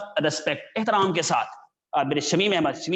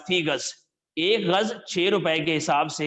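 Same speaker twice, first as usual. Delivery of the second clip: normal talking voice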